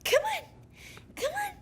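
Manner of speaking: very high coaxing cat call. Real, not acted. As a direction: coo up high to lure the cat. Calling a cat to come.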